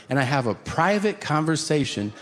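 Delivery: ordinary delivery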